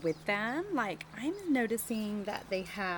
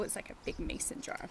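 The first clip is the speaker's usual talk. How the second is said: whisper aside; 2 semitones lower, 7 dB quieter than usual. Real, not acted. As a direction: lean in and whisper it like a secret. Aside to children while reading.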